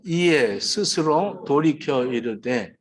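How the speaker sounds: slow solemn Bible reading; scripture reading. Acted. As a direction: read the verse slowly and solemnly. Reads scripture aloud.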